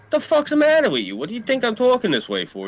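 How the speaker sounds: high-pitched mock-indignant retort; retold indignation. Acted indignant retort in retold confrontation.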